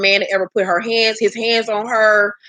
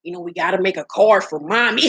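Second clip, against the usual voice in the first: lower, slurred bad-teeth impression; 4 semitones lower than usual. Host in character talking to the kids, mock slurred voice. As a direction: put on his mushy voice, barely holding it together. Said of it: "Okay, get character".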